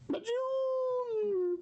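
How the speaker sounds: high shriek; cry out. Pretend. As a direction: shriek in mock fright.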